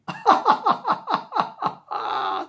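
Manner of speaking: very high, rattling staged laugh; long helpless stuttering guffaw; shaking laughter. A narrator's reading. Policeman so amused he starts shaking, laughing. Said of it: "he laughed so much he started shaking".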